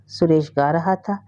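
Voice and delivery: ordinary delivery, nothing marked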